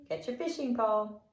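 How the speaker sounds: usual speaking voice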